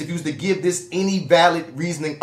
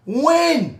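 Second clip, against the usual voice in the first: high-pitched incredulous cry; 11 semitones higher than usual. Real, not acted. Podcast host disputing her claim of being pushed, incredulous. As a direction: challenge it in outraged disbelief.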